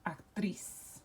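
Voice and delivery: actriz with the stress on the final "triz"; stress on last syllable. Shown as a demonstration. Teacher shows class how to pronounce the word, actriz stressed at the end. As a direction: say actriz slowly as a Spanish word, weight on "triz". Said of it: "we would pronounce that".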